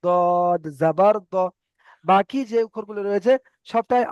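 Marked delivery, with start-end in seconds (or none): 0.1-1.4 দদ জাবার দ very slow, ending on short দ not দা; slow drilled reading. Shown as a demonstration. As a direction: stretch out দদ জাবার দ for learners. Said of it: "দা বলবেন না কেউ". (ধীরে টেনে দদ জাবার দ, দা নয়)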